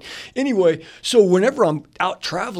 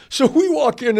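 usual speaking voice

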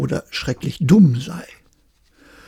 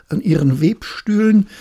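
ordinary delivery, nothing marked

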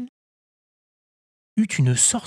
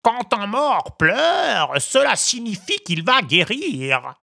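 high, slow, solemn pronouncement; solemn crow; 11 semitones higher, 4 dB louder, slower than usual. A narrator's reading. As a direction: declare it gravely like a learned crow. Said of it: "déclara alors le corbeau avec solennité". (voix de corbeau solennel)

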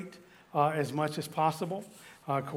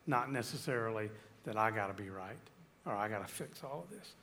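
low and soft, drawing listeners close; quiet intimate preaching; 4 semitones lower, 8 dB quieter than usual. Acted. Pastor softly corrects the idea of fixing everyone, low and quiet. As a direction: pull them in gently. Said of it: "drops to intimate low".

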